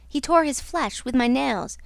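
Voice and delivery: ordinary delivery, nothing marked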